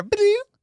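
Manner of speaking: high-pitched electronic ba-doop; imitated chime. Telling a friend about the gas light, high mock chime. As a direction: mimic the car's warning chime.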